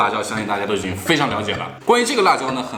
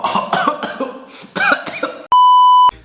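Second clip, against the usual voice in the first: hacking cough from chili fumes; harsh coughing fit; 5 dB louder than usual. Real, not acted. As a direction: cough hard, choking on spice. Coughing at pungent chili smell.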